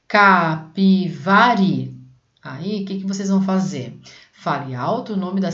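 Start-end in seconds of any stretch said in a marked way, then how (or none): 0.1-2.0 Capivari said slowly, syllable by syllable, loud: ca-pi-va-ri; slow syllable reading. Shown as a demonstration. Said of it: "Fale alto o nome da cidade prestando atenção". (Capivari bem devagar e alto, sílaba por sílaba)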